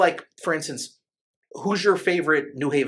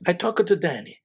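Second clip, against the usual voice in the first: put-on Italian accent, higher pitched; bad Italian accent; 9 semitones higher than usual. Man retelling the veal guy's pitch, bad Italian accent. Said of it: "This is my bad Italian accent".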